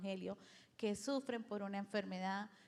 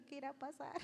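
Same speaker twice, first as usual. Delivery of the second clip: nervous laugh; 5 semitones higher, 6 dB quieter, faster than usual. Retold or imitated. Retelling her frightened prayer, laughing.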